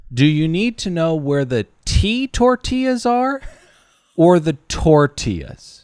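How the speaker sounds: high, mocking repeat of the stutter; snarky cashier mimic. Cashier mocking the stuttered question, high and sarcastic. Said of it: "what he hit me with was".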